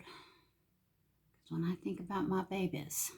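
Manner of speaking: slow, tearful whisper; emotional whisper. Real, not acted. A mother thinking of her daughters, slow tearful whisper. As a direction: let the words catch as you think of them. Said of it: "I'm trying not to get emotional".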